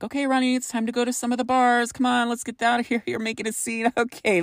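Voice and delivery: mock-soothing friend steering a drunk buddy outside; friends calming him.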